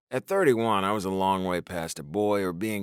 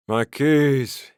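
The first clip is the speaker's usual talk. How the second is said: mumbled; 5 semitones higher, 8 dB louder than usual. A narrator's reading. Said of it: "he mumbled".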